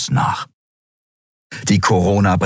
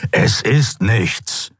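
slow, slightly raised snarl; hissed snarl; 4 semitones higher, much slower than usual. A narrator's reading. Skria dismisses the care for his wound, snarling. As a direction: snarl it through drawn-out words. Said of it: "fauchte der Skria".

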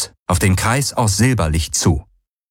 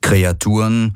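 ordinary delivery, nothing marked

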